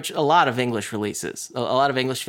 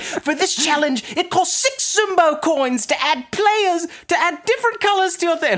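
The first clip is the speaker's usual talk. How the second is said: silly host voice. Retold or imitated. Hosts announce the challenge rules to contestants, silly over-excited voice. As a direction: hype up absurd rules in a silly high voice. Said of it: "I'm just doing a silly voice".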